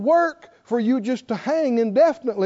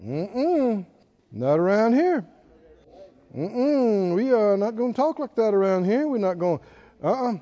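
disapproving refusal; 2 semitones lower than usual. Retold or imitated. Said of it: "both of our antennas go".

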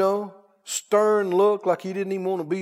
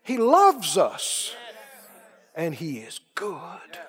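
dramatic preaching; 3 semitones higher, 4 dB louder, much slower than usual. Acted. Preacher reassuring the congregation, loud then hushed. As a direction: swell then whisper the promise.

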